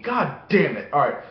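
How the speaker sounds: ordinary talk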